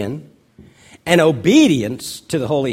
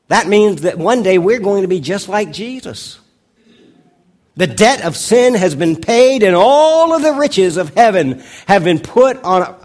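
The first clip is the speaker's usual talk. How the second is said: louder, lifted preaching build; pastor's closing appeal to the congregation, preaching; 5 semitones higher, 5 dB louder than usual. Acted. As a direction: proclaim it with rising conviction.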